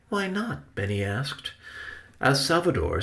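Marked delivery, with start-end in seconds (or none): 0.1-0.8 high, small questioning voice; high-voiced curious nephew; child-like questioner. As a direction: ask innocently, pitched way up. Benny questions his uncle.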